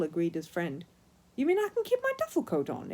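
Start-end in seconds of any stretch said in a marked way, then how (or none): 1.4-2.9 high eager exclaim. A narrator's reading. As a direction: pipe it up, bright and eager. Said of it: "exclaimed Paddington".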